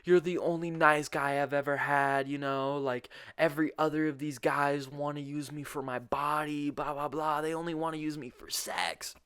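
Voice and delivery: high whiny imitation of girlfriend; hammed-up crying girlfriend voice; mock bawling. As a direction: wail it in a mock tearful plea. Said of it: "she just starts bawling".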